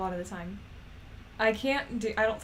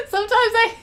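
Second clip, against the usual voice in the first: laughing hard; 13 semitones higher, 11 dB louder than usual. Real, not acted. Laughing hard at own confusion.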